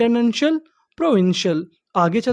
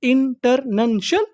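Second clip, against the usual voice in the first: International drawn out slowly, rising at the end; slow, stretched out; 2 semitones higher, much slower than usual. Shown as a demonstration. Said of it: "उसे क्या बोलते हैं". (International को धीरे धीरे खींचकर बोलिए)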